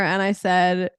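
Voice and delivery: ordinary talk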